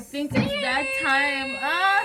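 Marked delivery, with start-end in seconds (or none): 1.6-2.0 startled shriek. Real, not acted. Sudden exclamation.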